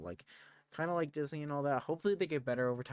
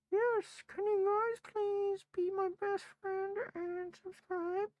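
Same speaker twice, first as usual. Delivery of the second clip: very high, slow squeaky cat voice; voicing the cat; slower than usual. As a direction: speak for the cat, tiny and sweet.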